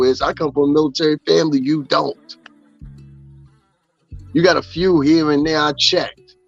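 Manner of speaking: ordinary talk, nothing marked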